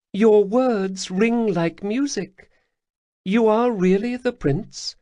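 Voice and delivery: high, purring alien lilt; Khajiit matriarch. Acted. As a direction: speak as a grateful cat-woman elder. Lesper questions the prince.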